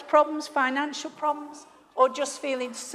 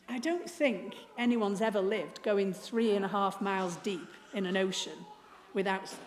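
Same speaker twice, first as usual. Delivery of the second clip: ordinary talk, nothing marked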